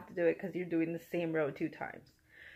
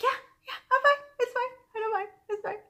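very high strained yeah, I'm fine; forced reassurance; 14 semitones higher, faster than usual. Retold or imitated. Reassuring the friend she is fine, very high and strained. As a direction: insist you're fine while clearly not.

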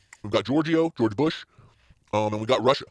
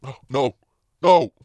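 anguished cry; 8 dB louder, much slower than usual. Pretend. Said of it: "like in those dramatic movies".

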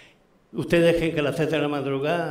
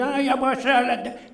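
onlookers' exclamation; 10 semitones higher, much slower than usual. Retold or imitated. Onlookers fret over old woman.

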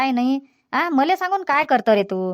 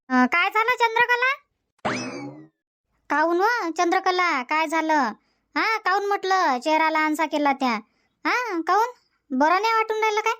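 higher, softer voice of a worried neighbour woman; concerned neighbour; 5 semitones higher, 4 dB quieter than usual. Acted. Neighbour Shantabai asks Chandrakala what is wrong, higher concerned voice. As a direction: ask gently, a lighter neighbour voice.

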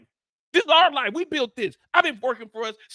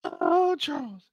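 mock sighing wife; 3 semitones higher, 4 dB quieter, slower than usual. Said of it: "She said".

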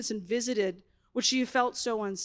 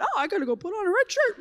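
mock husband; 6 semitones higher, faster than usual. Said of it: "my husband thought".

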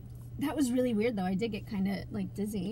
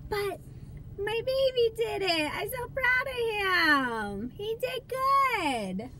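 higher, slow and adoring; proud mom; 7 semitones higher, slower than usual. Real, not acted. Mom telling viewers how proud she is, slow and doting. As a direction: linger on proud like a doting mother. Mom praising son on live.

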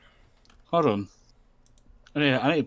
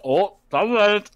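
mock dismay; 10 semitones higher, 8 dB louder, much slower than usual. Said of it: "I was like".

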